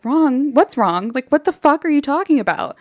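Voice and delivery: acted incredulous outburst; indignant disbelief. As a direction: play a baffled, put-out man demanding answers. Acts out indignant, baffled reply.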